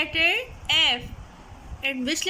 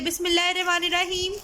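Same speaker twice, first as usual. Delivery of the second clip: prayer recitation; much faster than usual. Real, not acted.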